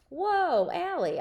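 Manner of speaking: high, loud mock surprise; surprised whoa. A narrator's reading. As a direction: pull back, amused. Brother reacting.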